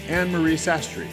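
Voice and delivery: usual speaking voice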